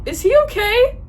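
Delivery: high, loud, baffled question; incredulous high-pitched check; baffled disbelief. Acted. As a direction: ask it high-pitched, utterly thrown. Baffled reaction to the message.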